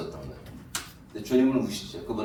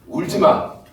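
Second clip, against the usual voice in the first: mock scolding, louder and higher; imitating a scolding voice; 11 semitones higher, 15 dB louder, much slower than usual. People telling the weeping one to stop, mock scolding. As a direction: snap at a crying child, hammed-up. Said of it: "그런 얘기 하시죠".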